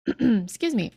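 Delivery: usual speaking voice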